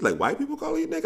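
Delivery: fast, very high disbelief; outraged disbelief, rushing; incredulous outburst. Real, not acted.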